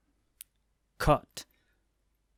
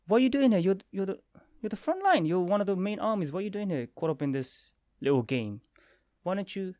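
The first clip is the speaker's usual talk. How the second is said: surprised questioning; 7 semitones higher than usual. Retold or imitated. Crew asking Marco why he left the front line, high and amazed. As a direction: press them, amazed they're here. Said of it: "they're like".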